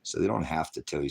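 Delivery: usual speaking voice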